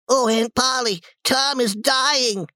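high wail, drawn out at the end; panicked. Acted. Sid telling his aunt Tom is dying, high-pitched panic. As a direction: cry out the dreadful news up high.

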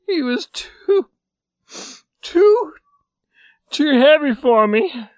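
very high, slow, sobbing; weeping. A narrator's reading. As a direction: cry the words out in shame. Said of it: "he began to weep".

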